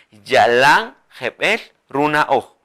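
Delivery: each word of Yalan jebel runa oh spaced out; slow dictation. Shown as a demonstration. Teacher dictates the sentence slowly for learners. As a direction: read the phrase slowly. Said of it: "vamos a decir".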